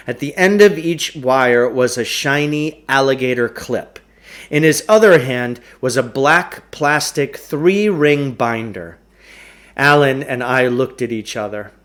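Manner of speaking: usual speaking voice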